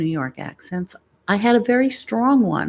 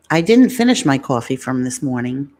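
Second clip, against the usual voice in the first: lower-pitched New York accent demo; put-on New York vowels, lower voice; 4 semitones lower, 3 dB louder than usual. Shown as a demonstration. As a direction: lean into New York "coffee". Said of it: "I love a New York accent".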